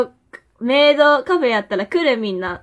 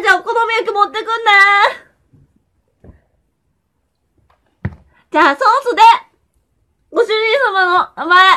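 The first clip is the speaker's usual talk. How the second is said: high, sugary maid voice; sugary maid cafe greeting; 8 semitones higher, 6 dB louder than usual. Pretend. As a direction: coo at the master like a maid. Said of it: "この声やだね". (甘いメイド声)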